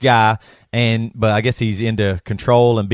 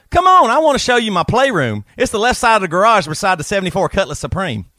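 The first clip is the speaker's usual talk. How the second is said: Travis from South Carolina, hammed right up; 8 semitones higher than usual. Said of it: "[name] reading Fifty Shades of Grey".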